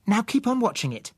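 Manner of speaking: put-on high, firm child voice; girl commanding. Susan directing Bill at the glasses, high firm child voice. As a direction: tell him what to do. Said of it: "she said".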